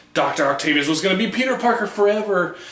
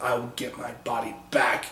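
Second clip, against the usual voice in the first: hushed vow; 6 semitones lower, 8 dB quieter than usual. Retold or imitated. Peter in Octavius's body vows.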